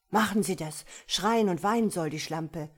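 loud, harsh demand. A narrator's reading. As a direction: bark it out, eager for cruelty. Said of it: "Diesmal erhob Herr Kederfiel seine Stimme".

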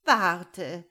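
very loud, urgent appeal; imploring shout; pleading. A narrator's reading. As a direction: stop them with a desperate cry. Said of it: "flehte er".

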